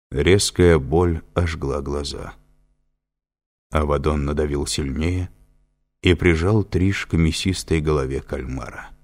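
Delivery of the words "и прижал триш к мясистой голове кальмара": ordinary delivery, nothing marked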